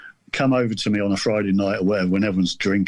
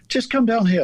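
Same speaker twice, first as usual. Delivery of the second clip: mum's firm call, pitched much higher; mum insisting; 11 semitones higher than usual. Retold or imitated. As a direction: order him downstairs, high and insistent. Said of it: "And then she said".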